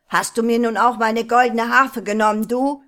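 laut und hoch geschrien, schnell; Schrei. Acted. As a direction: schrei die Frage empört heraus. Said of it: "schrie der Troll". (der Troll schreit den Dieb seiner Harfe an)

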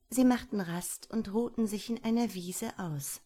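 ordinary delivery

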